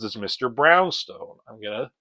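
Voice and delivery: menacing villain. Retold or imitated. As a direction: purr the threat down the phone. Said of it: "He says".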